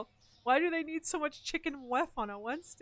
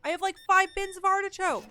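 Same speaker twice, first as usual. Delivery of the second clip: loud, high, fed-up protest; exasperated outburst; 8 semitones higher, 9 dB louder than usual. Real, not acted. Frustrated player venting at a game customer, loud and exasperated.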